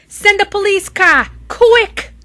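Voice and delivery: high, loud urgent call; urgent yell. Acted. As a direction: demand the car right now. Michael yells for help.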